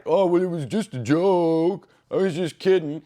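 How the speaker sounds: a hint of a dismissive jerk shrugging it off; mock excuse. Mocking the boyfriend's excuse.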